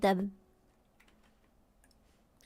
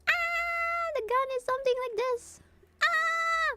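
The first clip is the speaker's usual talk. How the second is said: much higher panicked character voice; high panicked squeal; panicking character; slower than usual. Acted. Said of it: "She's panicking".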